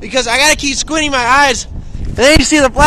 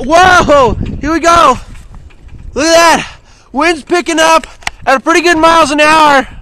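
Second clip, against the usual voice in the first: excited shouting over wind; 4 semitones higher, 6 dB louder than usual. Real, not acted. Wind gusts hit him.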